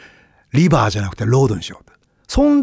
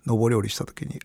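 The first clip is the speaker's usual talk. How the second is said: usual speaking voice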